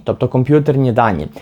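normal talking voice

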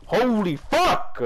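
slow drawn-out exasperated cry; hyped gamer bro. Real, not acted. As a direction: stretch it out in disbelief. Gaming frustration outburst.

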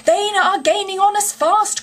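high, urgent cry of alarm. A narrator's reading. Engine driver warning Toad of pursuers, crying out. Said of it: "cried the engine driver".

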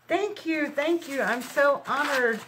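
high-pitched, gushing thanks; honored and touched, pitched well up; overwhelmed gratitude. Real, not acted.

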